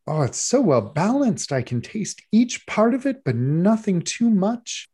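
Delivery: high, gushing judge swooning over a bite; a doting judge raising pitch in delight; rapturous judge. Acted. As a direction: swoon over every flavour. Judge Jane savours the cake.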